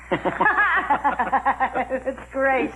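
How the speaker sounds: gloating laugh into the words; amused, savoring it; laughing at Gina's downfall. Real, not acted. Gloating over rival's arrest.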